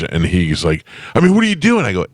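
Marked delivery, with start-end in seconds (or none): 1.1-1.9 high-pitched, indignant mock protest; indignant outburst. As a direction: act out an outraged passenger. Acted outburst of angry car passenger.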